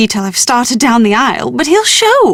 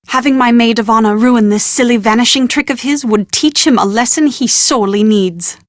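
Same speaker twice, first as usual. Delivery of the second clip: ordinary talk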